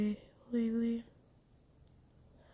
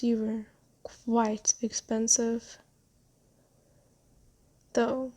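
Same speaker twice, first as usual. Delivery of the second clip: ordinary talk, nothing marked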